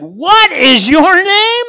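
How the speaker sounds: loud, high, drawn-out commander's shout; roared, stretched-out question; booming demand. Acted. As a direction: thunder each word slowly at a cowering soldier. Acted commander demands boy's name.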